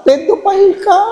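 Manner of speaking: high, sing-song jab; mock accuser. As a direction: mimic the gossip's jab.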